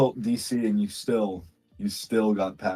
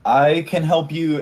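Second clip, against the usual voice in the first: perky barkeep; 7 semitones higher, 5 dB louder than usual. Acted. Barkeep offers help brightly.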